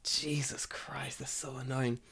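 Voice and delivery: unvoiced exasperated whisper; soft slow whispered groan. Real, not acted. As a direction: breathe it out under your breath, slowly.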